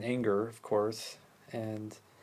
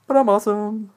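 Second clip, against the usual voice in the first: hammed-up boast; 9 semitones higher, 11 dB louder than usual. Boastful sign-off in put-on voice.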